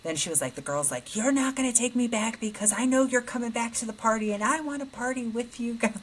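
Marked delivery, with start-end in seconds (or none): 1.2-6.0 put-on higher, whining drunk college girl; whiny drunk girl. Drunk girl whining to the daughter about leaving the party. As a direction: plead like a tipsy girl who won't go home.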